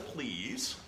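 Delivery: ordinary talk, nothing marked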